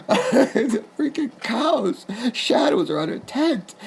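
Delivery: can barely get it out for laughing. Real, not acted. Telling listeners about the cow shadows, laughing hard.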